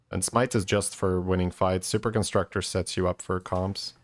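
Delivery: normal talking voice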